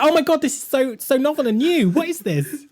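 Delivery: high, gushing PR enthusiasm; mock gushing excitement. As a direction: gush like a thrilled publicist. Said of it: "And they'll be like".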